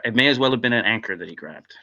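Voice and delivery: ordinary talk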